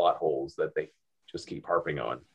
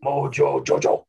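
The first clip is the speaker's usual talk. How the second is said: Mojo Jojo impression; 5 semitones higher, 3 dB louder than usual. Everyone voicing Mojo Jojo.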